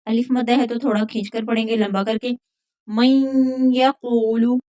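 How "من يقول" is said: من يقول with the nun merged into يقول, held nasal hum; nasal merge. Shown as a demonstration. Teacher showing idgham with ghunna on من يقول, slow nasal merge. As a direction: glide from من into يقول through a humming ghunna. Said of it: "यहाँ पर इदगाम बा गुन्ना होगा".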